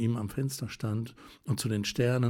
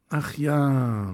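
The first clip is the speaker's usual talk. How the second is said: impatient; 4 semitones higher, 5 dB louder than usual. A narrator's reading. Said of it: "es klang wie Ungeduld aus ihrer Stimme".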